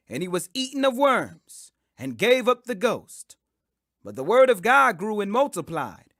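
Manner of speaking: plain reading voice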